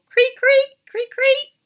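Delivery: very high, loud creak creak; high squeaky cricket calls; cricket chirping. Acted. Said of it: "and the crickets go".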